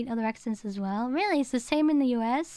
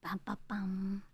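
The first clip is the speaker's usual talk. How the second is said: whispered bum bum bum; 6 semitones lower, 12 dB quieter than usual. Pretend. Dramatic tag after accent joke.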